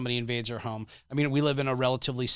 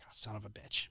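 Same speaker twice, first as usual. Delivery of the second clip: deflated mutter; 3 semitones lower, 14 dB quieter than usual. Retold or imitated. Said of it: "I was like".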